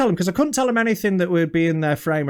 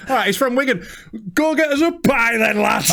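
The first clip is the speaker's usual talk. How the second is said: put-on Wigan accent; 5 semitones higher than usual. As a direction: shout it like a Wigan lad. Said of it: "how Wigan people speak".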